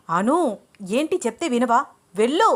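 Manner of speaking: scold, then snap out the command loudly; rising to an angry shout; angry order. A narrator's reading. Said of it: "కోపంగా అన్నాడు అభిరామ్".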